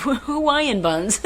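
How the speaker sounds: high, slow words through laughter; laughing. Real, not acted. Teasing about the baby's rolls, laughing. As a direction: draw it out high, breaking into laughter.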